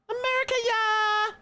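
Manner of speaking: very high, loud cheer; hammed-up high cheer; mock patriotic cheer. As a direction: whoop it like a cartoon fan. Cheers a chat joke.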